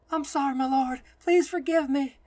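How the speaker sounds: high, loud desperate plea; pleading. A narrator's reading. Kneeling nun begs the stranger's forgiveness, pleading. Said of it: "she pleads".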